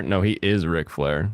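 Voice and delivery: ordinary delivery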